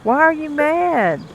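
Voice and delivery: high, slow, drawn-out why are you mad; mock puzzled whine. Cafe owner teasing a grumpy customer, high and slow. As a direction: stretch it out high like teasing a sulky kid.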